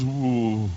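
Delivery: long, low, soft ooh; imitated ooh. Girl whenever the preacher sees her, imitated low ooh. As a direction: let out a hushed, drawn-out ooh.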